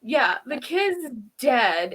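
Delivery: usual speaking voice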